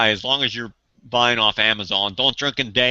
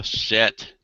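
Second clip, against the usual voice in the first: ordinary talk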